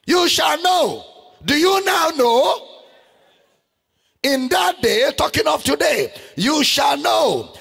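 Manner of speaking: fiery pulpit preaching; preaching at full force. Acted. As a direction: thunder the scripture to the crowd.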